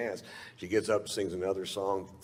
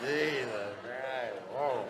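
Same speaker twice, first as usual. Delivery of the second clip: put-on slow, higher, woozy drunk; drunken reeling; 5 semitones higher, 4 dB quieter, slower than usual. Comic amazed at how drunk he is, drunken reeling. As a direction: stretch it out as if reeling from drink.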